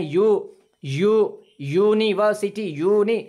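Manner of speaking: यू said firmly with rounded lips, then यूनिवर्सिटी; model sounds to repeat. Shown as a demonstration. Teacher modelling यू for students to copy. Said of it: "आप खुद बोल के देखें".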